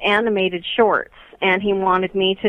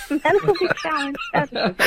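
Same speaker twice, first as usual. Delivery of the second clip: laughing; 7 semitones higher than usual. Real, not acted. Amused echo of the question.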